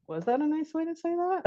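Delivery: high, soft put-on voice; put-on parent question. Shown as a demonstration. Parent questioning a child's manners, high soft voice. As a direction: pitch the whole question up high.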